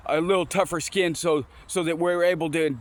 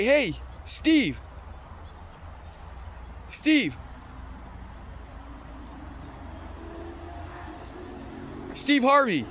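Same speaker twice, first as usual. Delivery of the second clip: lightly voiced host calling out a name, raised pitch; 5 semitones higher, 6 dB quieter, much slower than usual. Guy calls the next comic up at open mic, mild calling out. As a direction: imitate a club host calling someone up, with long waits.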